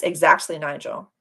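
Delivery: ordinary delivery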